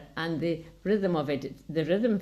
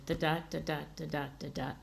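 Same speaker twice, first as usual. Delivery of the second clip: da da da da da da da da, quick even beats, softer, stress on every second da; quick soft da-da rhythm demo; ballad meter rhythm; 6 dB quieter, faster than usual. Shown as a demonstration. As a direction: tap out the iambic beat on nonsense syllables. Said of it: "that ballad meter".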